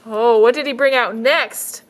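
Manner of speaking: usual speaking voice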